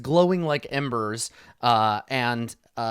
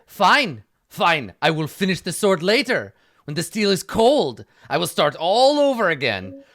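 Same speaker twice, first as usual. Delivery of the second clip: loud, higher, put-upon craftsman; gruff smith calling out; 5 semitones higher, 6 dB louder than usual. Acted. Smith shouting from the back room, grudging.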